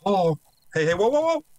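sudden high alarmed whoa; real startled yelp. Real, not acted. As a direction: jump back at a gun in your face. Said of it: "Scared the living shit".

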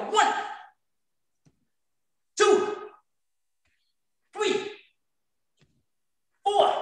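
high, called-out count ending in a yell; drill count. Real, not acted. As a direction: call each rep, then shout. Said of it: "We're gonna be yelling".

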